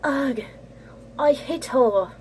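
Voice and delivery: put-on disgusted line in a British accent; a touch of makeshift British accent. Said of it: "Apparently I gave her a British accent too".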